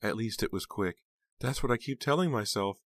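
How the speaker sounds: high, plaintive grieving voice; crying aunt. A narrator's reading. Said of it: "wrapping Heather in her arms and crying".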